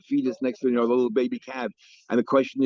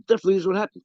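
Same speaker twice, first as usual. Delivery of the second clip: higher-pitched, insistent counterclaim; emphatic counterclaim; 6 semitones higher, much faster than usual. Acted. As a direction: insist just as firmly as the opponent. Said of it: "each one is emphatic".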